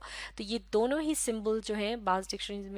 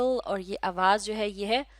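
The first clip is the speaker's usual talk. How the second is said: usual speaking voice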